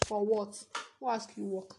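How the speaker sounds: ordinary delivery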